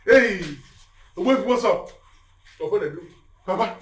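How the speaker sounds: ordinary talk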